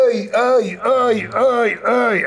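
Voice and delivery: ayes agudos de dolor, medio actuados; quéjate como si doliera el cierre; quejido de dolor. Retold or imitated. (imitando sus quejidos mientras el doctor quita el cierre)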